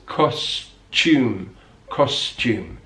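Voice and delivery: "costume" said kos-chume, t and y merged to ch; British "costume" with a clear ch; yod coalescence. Shown as a demonstration. Teacher demonstrating yod coalescence in British English, British pronunciation. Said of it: "we have a kind of ch".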